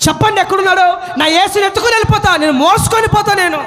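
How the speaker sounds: high, urgent plea; pleading Mary. Acted. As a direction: beg insistently, voice lifted in desperation. Acts Mary begging. (ఆర్తితో ఎలుగెత్తి వేడుకుంటూ)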